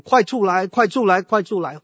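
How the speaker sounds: loud high urgent shouts to wake a sleeper; neighbours yelling. Retold or imitated. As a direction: yell it like the house is burning. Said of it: "所以每个人就大喊大叫".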